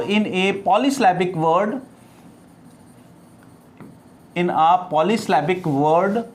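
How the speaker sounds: slow, spaced-out dictation reading. Real, not acted. Teacher dictates a definition to students, slow dictation. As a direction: dictate word by word with long pauses.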